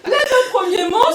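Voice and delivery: laughing out the words, loud and high; words squeezed through real laughter. Real, not acted. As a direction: still giggling through it. Laughing after her own joke.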